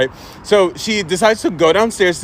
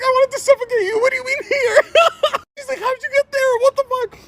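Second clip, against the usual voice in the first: much higher, laughing through mom's panic; laughing mock mom. Panicked mom scolding her son, laughing through it. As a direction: imitate mom while cracking up. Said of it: "My mom was like".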